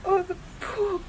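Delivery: tearful pity. Acted. Woman upset over the kitten.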